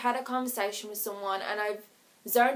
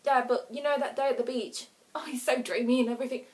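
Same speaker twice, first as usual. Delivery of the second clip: ordinary talk, nothing marked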